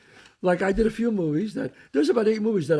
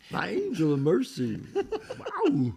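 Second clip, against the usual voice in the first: ordinary talk, nothing marked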